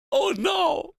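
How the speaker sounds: high startled oh no; surprised cry. Real, not acted. Streamer finding the karaoke track exists, high surprised cry. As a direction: yelp it out in happy alarm.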